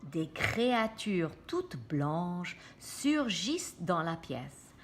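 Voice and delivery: usual speaking voice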